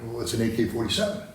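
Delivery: usual speaking voice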